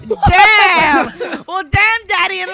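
loud stunned yell; shocked shout. Real, not acted. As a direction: blurt it out in shock. Shocked reaction to chair story.

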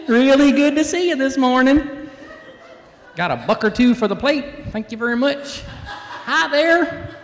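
loud, high, hollered Southern accent. Shown as a demonstration. Preacher calls the kids up front, big loud Southern accent. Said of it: "where most people talk like this".

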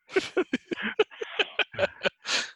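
breathy burst of laughter; long wheezy chuckle; laughing. Real, not acted. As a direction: crack up at your own joke. Joking about his accent attempt.

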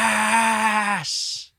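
high, long-drawn "Yes!"; retold shout of celebration, high and sustained; shouted cheer. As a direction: imitate a fan's cheer, stretched right out. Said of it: "This Scouser just shouts out".

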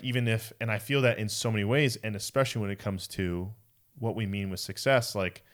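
ordinary delivery

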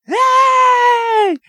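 Richard Simmons. Retold or imitated. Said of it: "just come out and be like".

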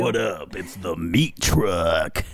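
slow, low hype wrestler intro. Pretend. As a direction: drawl it like a WWE entrance. Intro as wrestler Meat Truck.

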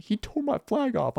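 high-pitched imitation of an upset child; mock bawling kid. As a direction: tattle like a kid in tears. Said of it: "he's just bawling".

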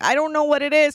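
half-hearted crying voice; mock crying. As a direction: imitate tearfully complaining to your mother. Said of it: "I start crying to my mom".

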